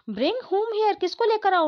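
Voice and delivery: baffled question. Acted. Father baffled by demand.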